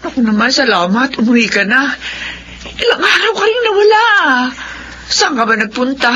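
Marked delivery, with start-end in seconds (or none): 2.8-4.1 tearful. Acted. Mother greets returning son.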